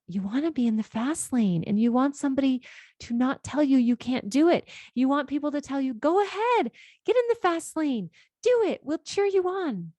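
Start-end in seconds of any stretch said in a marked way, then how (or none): 5.9-9.9 bright, much higher cheer of encouragement; a mock cheer from the sidelines. Imitating friends cheering a client into the fast lane, excited.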